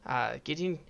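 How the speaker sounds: breezy, smug acquaintance; Clive voice. Acted.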